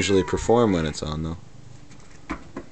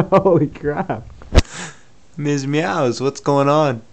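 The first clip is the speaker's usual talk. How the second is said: excited pet talk; 6 semitones higher, 5 dB louder, slower than usual. Real, not acted. Excited at the cat's antics.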